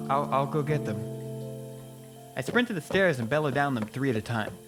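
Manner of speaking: ordinary delivery, nothing marked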